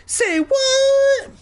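very high, loud squawk; over-the-top high shriek of surprise; comic outrage. Acted. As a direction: squeal it in shocked disbelief. Doctor D grimaces at the confession.

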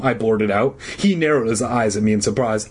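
usual speaking voice

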